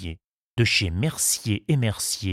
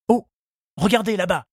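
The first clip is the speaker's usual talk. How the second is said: high-pitched suitor's cry; excited exclamation; 10 semitones higher, 4 dB louder than usual. A narrator's reading. Charles shows Henriette the island, exclaiming. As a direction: call out high, pointing something out. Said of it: "s'écria-t-il".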